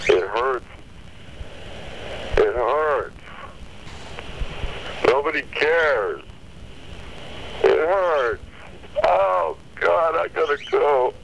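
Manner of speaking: rising anguish. Real, not acted. Pained caller's pitch climbing.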